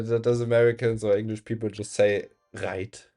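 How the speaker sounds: ordinary talk, nothing marked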